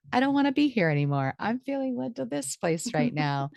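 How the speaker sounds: light, high voice of a fresh whim; sudden new impulse. Acted. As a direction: act out being pulled somewhere new.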